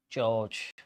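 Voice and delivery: normal talking voice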